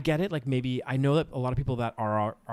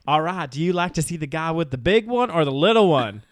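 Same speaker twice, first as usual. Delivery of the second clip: twangy Southern impression, pitched up; Joe Exotic; 4 semitones higher, 4 dB louder than usual. Joe giving Travis a choice, put-on Southern twang. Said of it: "your Joe Exotic, uh, impression".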